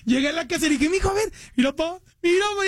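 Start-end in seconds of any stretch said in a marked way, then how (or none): 0.9-2.0 put-on excited kid. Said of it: "Ya es que se emocionan". 2.2-2.7 a son showing his dad the album, excited; excited kid, higher. Retold or imitated. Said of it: "Ya es que se emocionan".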